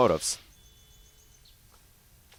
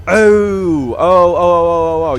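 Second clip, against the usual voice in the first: excited; 3 semitones higher, 10 dB louder, slower than usual. Real, not acted. Spots cargo ready, excited.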